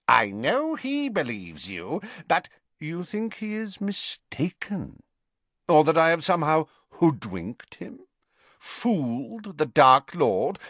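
high, sneering witch voice; taunting, shrill disbelief; scornful Bellatrix. Acted.

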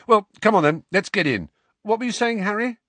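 briskly herd them in; brisk Hermione. Acted.

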